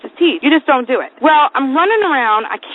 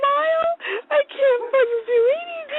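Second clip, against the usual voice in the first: very high-pitched tearful wail; mock bawling; 14 semitones higher than usual. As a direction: sob it out, comically helpless. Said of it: "and I start crying".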